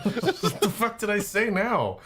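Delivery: laughing protest. Real, not acted.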